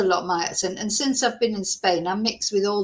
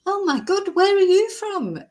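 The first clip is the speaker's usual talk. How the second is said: put-on much higher, astonished Mancunian accent; Mancunian Kevin and Perry accent; 11 semitones higher, 3 dB louder than usual. Kebab shop guy gasps at her accent, high hammed-up Mancunian accent. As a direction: squeal it like an amazed Manchester teenager. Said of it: "the Mancunian Kevin and Perry accent".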